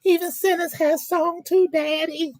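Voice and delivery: high, crying mock plea; hammed-up sobbing plea to daddy; tearful mock plea. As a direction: beg daddy through fake tears. Mock preacher crying scene.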